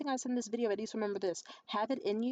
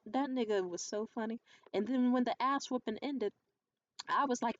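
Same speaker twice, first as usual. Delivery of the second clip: ordinary talk, nothing marked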